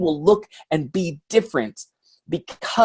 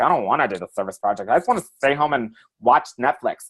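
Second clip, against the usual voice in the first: lower, reluctant, put-upon tone; reluctant whine; 4 semitones lower than usual. Pretend. Pretend volunteer dreading a service project, lower reluctant voice. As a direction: sound like you'd rather stay home. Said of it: "you're like".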